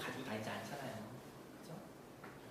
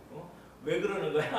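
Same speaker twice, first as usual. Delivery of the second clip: lightly acted annoyed retort; mock irritation; 2 semitones higher than usual. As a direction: snap back, half in jest. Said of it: "신경질 팍 냈다고 생각했어요". (짜증 내는 척 따지는 말투)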